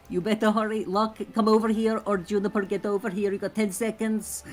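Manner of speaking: high, loud in-character summons; urgent hurrying. Acted. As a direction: call them over with urgency. Nina hurries companions to the boat.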